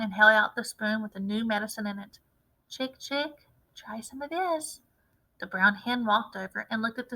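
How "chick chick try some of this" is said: softer, higher coaxing call; coaxing hen softly. A narrator's reading. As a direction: lure the hen in gently.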